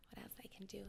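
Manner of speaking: whispered. Retold or imitated. Quoting her own thought.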